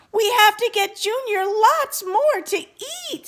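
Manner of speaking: high, loud bird-parent outcry; urgent exclamation. Acted.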